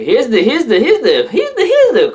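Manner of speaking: high, loud stammer on repeated words; stuttering from how crazy it is; excited stutter. Real, not acted. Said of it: "I'm stuttering 'cause it's".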